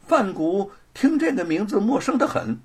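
usual speaking voice

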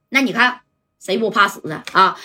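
plain reading voice

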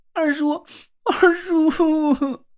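high, tearful sobbing call; aggrieved crying. Acted. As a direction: cry out to him like a wronged child. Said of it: "委屈地哭了起来".

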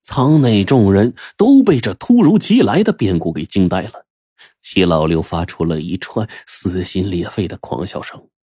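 usual speaking voice

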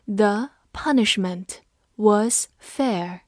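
The punishment was fair said slowly, each word spaced out; slow reading. Shown as a demonstration. Teacher slowly reading the sentence aloud for students. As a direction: read it slowly for the class to follow. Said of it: "这句话我们慢读一遍".

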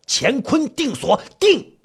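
slow chant jumping to a high command; spell incantation. Acted. Fifth brother casting the locking spell, commanding. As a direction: intone it, then fire off the last word high.

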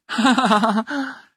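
hearty laughing burst; loud child laugh. A narrator's reading. 放牛娃笨笨对哭鼻子的孙悟空哈哈大笑. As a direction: laugh out loud like a little boy. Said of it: "笨笨大声地笑了起来".

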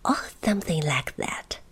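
plain reading voice

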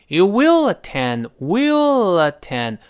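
slow You will attend, will barely linked to attend; slow demo of will attend with a faint link; careful slow linking; light linking. Shown as a demonstration. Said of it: "有个非常轻的连读".

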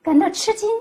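usual speaking voice

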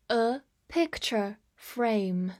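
slow, each word on its own; slow model reading. Shown as a demonstration. Teacher repeats the phrase for learners, slow and separated. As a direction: pause after every word.

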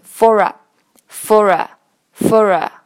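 slow for a run together as one word; linking demo. Shown as a demonstration. Teacher shows students how for a links. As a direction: glide for into a smoothly. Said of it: "for和a连读".

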